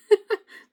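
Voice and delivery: sudden loud burst of laughter; quick laugh at herself; short laugh. Real, not acted. Laughing at her own numb face.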